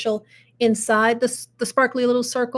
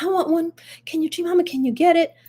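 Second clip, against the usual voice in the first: lightly voiced grandchild, higher pitch; a hint of a wheedling child, pitched up; imitating pleading grandkids; 7 semitones higher, faster than usual. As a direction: beg grandma like an eager kid. Grandkids begging grandmother, voiced by her.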